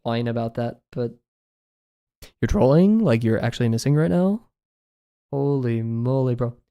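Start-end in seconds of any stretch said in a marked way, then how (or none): 2.4-6.6 put-on Valorant accent with swooping uptalk. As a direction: imitate a Valorant accent, phrase endings curling upward. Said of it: "it's kinda like up talking".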